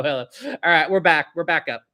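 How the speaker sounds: ordinary delivery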